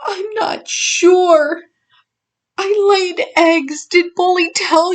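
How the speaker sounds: high, breathy whispered whimper; a scared little whimper, barely voiced. A narrator's reading. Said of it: "she whimpered".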